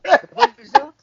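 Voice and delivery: high, loud laughing question. Real, not acted. Friend asking about the gym video, laughing loudly. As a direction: laugh it out in disbelief.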